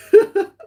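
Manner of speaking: a sudden high, loud squeal of laughter; squealing cackle. Real, not acted. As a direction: burst out in a shrieking laugh. Laughing hard.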